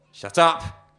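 a guard shouting down the prisoner; thunderous shout. Acted. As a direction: blast it out to silence him. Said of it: "A voice louder than anything before".

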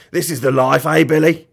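loud, contented rogue; a hint of rough, matey villain; lightly voiced villain. Mister Gum relaxing with Billy, lightly voiced villain.

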